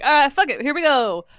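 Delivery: reckless. Pretend. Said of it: "Seda is just gonna be like".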